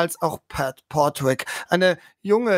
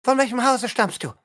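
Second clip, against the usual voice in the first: hohe, schnelle herablassende Lady; hochmütige Adlige; 4 semitones higher, much faster than usual. Acted. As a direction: mustere die Fremde verächtlich. Spielleiter spielt Lady Lilla. (Lady Lilla verhört Bronwyn hochmütig)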